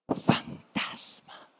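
slow, drawn-out frightened whisper; spooky whisper. Acted. Preacher telling how the disciples saw a ghost, frightened whisper. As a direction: breathe it out like a ghost story.

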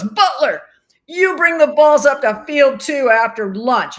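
loud, high barked order to a trainee; barking instructor. Retold or imitated. Instructor barking orders at a new trainee, loud and high. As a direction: bellow it like a gruff umpire instructor.

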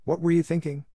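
high-pitched, indignant question; demanding. A narrator's reading. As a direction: demand an answer as a cross schoolmistress. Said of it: "demanded Miss Minchin".

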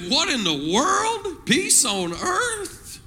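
"What in the world? Peace on earth?" slow, with high swooping ends; incredulous question. Shown as a demonstration. Preacher offers church a wording, incredulous questioning swoop on "Peace on earth?". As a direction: draw out "world" and "earth" in exaggerated disbelief. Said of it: "Say that like a question".

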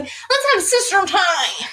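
high, stretched-out sing-song eagerness; over-eager, hammed-up little-sister plea; retold gushing invitation. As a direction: gush it slowly, voice way up.

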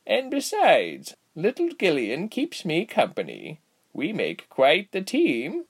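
put-on high, slow, lilting old lady voice; cheery aged healer, swooping pitch; amused old woman. A narrator's reading. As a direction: stretch the words like a merry granny. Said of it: "the elderly woman giggled".

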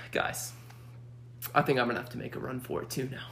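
soft, low, hurried confession; grave resolve. Retold or imitated. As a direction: imitate a leader quietly volunteering. Retold leader's grave resolve.

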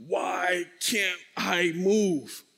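very slow, stretched-out words; frozen slow motion. Retold or imitated. Man frozen at the door asking himself, slow-motion. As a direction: draw each word out as if paralyzed.

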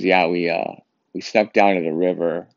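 ordinary delivery

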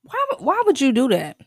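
exasperated loud why; loud disbelief. Real, not acted. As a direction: demand an answer, loud and stunned. Disbelief at the teammates' bet.

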